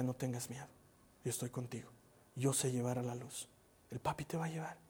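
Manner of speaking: quiet, soothing murmur; calm a little child close to your ear; soft reassurance. Retold or imitated. Father soothes his daughter as he carries her, softly. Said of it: "le decía".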